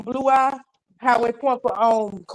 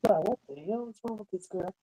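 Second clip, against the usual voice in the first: ordinary talk, nothing marked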